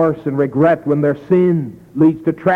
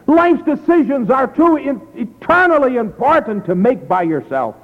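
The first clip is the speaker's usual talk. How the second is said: higher, urgent altar-call appeal; fervent preaching; 8 semitones higher than usual. Acted. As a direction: plead with the congregation to give their hearts.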